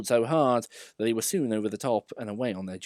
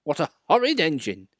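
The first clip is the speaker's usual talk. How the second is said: high, loud indignant cry; shocked coaches; 6 semitones higher, 5 dB louder than usual. A narrator's reading. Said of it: "screamed Agnes".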